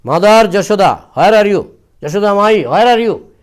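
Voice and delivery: shouting. A narrator's reading. Gopi at Jashoda's house calling for her, loud shouting. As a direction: call across the house to find her. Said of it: "started shouting".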